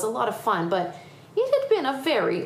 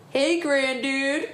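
slow, higher drawn-out greeting; calling out; 6 semitones higher, much slower than usual. Acted. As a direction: hail him as a cowboy would. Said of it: "called the cowboy".

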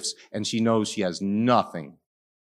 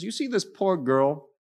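much higher, earnest rebuke to the host; pleading Jesus; 8 semitones higher than usual. Retold or imitated. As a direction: raise the voice high in gentle reproach. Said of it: "He says".

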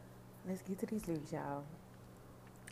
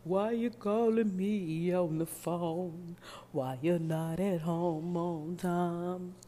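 slow, louder mock woman with a deep voice; 4 dB louder, much slower than usual. Miss Thing snapping at her man on the phone, slow and louder. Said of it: "she got that deep voice".

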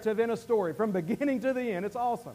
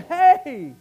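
high, earnest pleading call; Jesus pleading through tears with the crowd; weeping plea; 7 semitones higher, slower than usual. Acted. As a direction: beg them to see him. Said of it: "Jesus is standing there weeping and saying".

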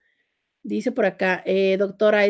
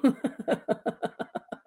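breathy, trailing chuckle; laugh; 9 dB quieter than usual. Real, not acted. Host laughs at a viewer's comment, quietly amused. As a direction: laugh softly and let it run out.

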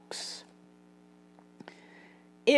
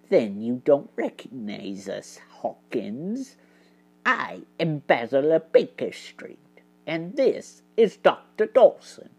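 much softer, near whisper; hushed voice; 5 semitones lower, 5 dB quieter than usual. Acted. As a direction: quietly reveal yourself. Basil reveals himself quietly.

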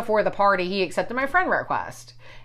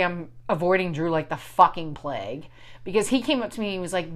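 ordinary talk, nothing marked

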